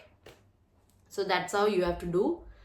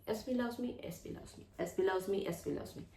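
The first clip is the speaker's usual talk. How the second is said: near-whisper repetition; 9 dB quieter than usual. Shown as a demonstration. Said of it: "you can affirm like that".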